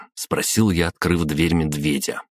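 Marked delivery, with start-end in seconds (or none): none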